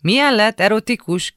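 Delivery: high, loud questioning call; shouting back. A narrator's reading. Brother shouting back from the shower, loud and high. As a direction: yell back as if half-deaf to it. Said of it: "kiáltotta vissza Ádám".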